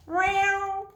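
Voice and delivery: high, squeaky kitten meow; puppy meow. Acted. Puppy answers his mother with a tiny high meow. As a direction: squeak it out like a little cat. Puppy meows instead of barking.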